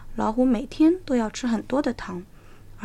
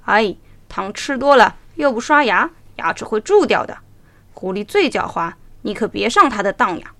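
lion advising; 7 dB louder than usual. A narrator's reading. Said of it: "狮子来劝他说".

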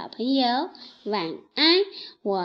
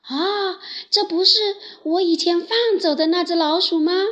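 higher, louder and fast, startled; surprised recognition; 6 semitones higher, 5 dB louder, faster than usual. Acted. Trapped lion suddenly recognises the mouse he freed.

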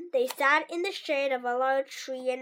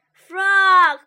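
much louder, higher call of a name; loud cry; 6 semitones higher, 13 dB louder than usual. A narrator's reading. Toad covered in sticks, shouting for his friend, loud. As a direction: shout out across the pond. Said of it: "cried the thing".

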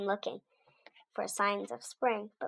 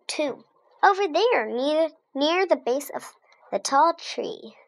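pointing it out; 6 semitones higher, 6 dB louder than usual. A narrator's reading. Pointing out the flower.

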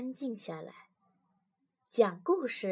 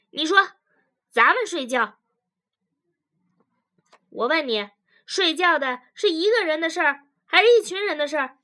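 loud, pitched-up interrogation; confrontational questioning; 6 semitones higher, 8 dB louder, faster than usual. Acted. Wife grills husband about sleeping, confrontational. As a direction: press for an answer, loudly.

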